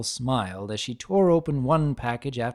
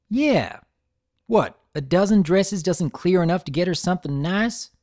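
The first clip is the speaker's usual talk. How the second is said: taunting; 6 semitones higher, 4 dB louder than usual. A narrator's reading. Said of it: "Applejack taunted".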